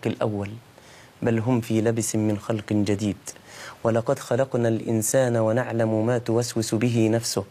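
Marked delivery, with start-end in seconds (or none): none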